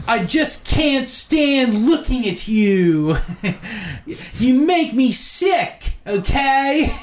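put-on high, slow shouting Pennsylvania accent; Angry Video Game Nerd. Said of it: "Angry Video Game Nerd has that accent".

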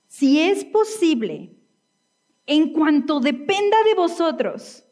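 versículo lento, en tono alto; lectura bíblica enfática. Real, not acted. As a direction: proclama el versículo despacio, subiendo la voz. Lee el versículo con énfasis. (lectura enfática y pausada del versículo)

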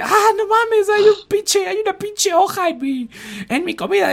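high, loud whiny tourist voice; gringo tourist. Retold or imitated. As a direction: squeal it like a shocked American tourist. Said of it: "vino un pinche gringo".

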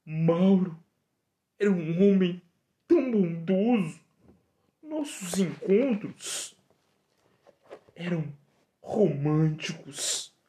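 high, distressed female voice; high woman's voice. Acted. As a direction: play the abandoned woman, high. Woman laments her lover.

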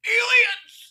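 shrill high shout of alarm; hammed-up cry of alarm; mock alien alarm. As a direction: yell it like a panicked conspiracy fan. Mock excited shout about aliens.

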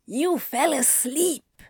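high, drawn-out hiss of reproach; exasperated hissed accusation. A narrator's reading. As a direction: hiss it in slow disbelief. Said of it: "Anthony hissed at him".

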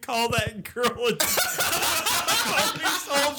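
high, loud laugh through the words; cracking up while telling it. Real, not acted. Laughing retelling Biden's insult.

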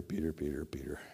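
fading whisper of his name; softly despairing whisper. Acted. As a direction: breathe the name, dying away.

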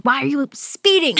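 high-pitched, scolding mock outrage; mad mom. Mom scolding her daughter in the car, mad. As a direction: imitate a mom telling off the driver. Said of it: "My mom gets so mad in the car".

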